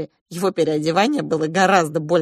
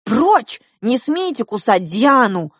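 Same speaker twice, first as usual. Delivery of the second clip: loud, high-pitched protest; indignant cry; 6 semitones higher, 6 dB louder, slower than usual. A narrator's reading. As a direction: shout the intruder off, protecting a friend. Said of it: "вскричала я".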